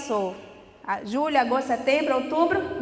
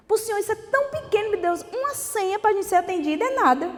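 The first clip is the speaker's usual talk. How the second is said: oração imitada; 8 semitones higher, faster than usual. Retold or imitated. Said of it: "ele fez uma oração".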